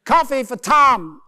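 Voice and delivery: loud, high, drawn-out American barista; American accent. Acted. New York barista calling Tom's order, loud American accent. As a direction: holler the order slowly in hammed-up American. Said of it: "maybe just adopt that one".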